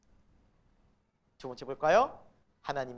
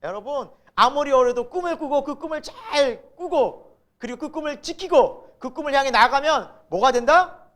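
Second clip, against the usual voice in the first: loud, high, rousing exhortation; hammed-up inspirational preaching; motivational preacher; 8 semitones higher, 10 dB louder than usual. Preachers urging young people to keep dreaming, imitated fervour. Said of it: "젊은이들에게 설교자들이".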